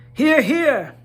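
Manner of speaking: high, loud, blurted out; blurted cheer. A narrator's reading. Bilbo cheering the wizard aloud by accident, high and eager. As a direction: let it slip out eagerly. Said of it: "accidentally said it out loud".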